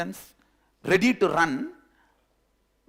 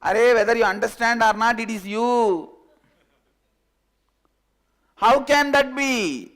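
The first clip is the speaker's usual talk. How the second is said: calling out; 7 semitones higher, 10 dB louder than usual. Acted. Lamb calls across to the lion, loud. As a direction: shout it across the distance. Shouted exchange at distance.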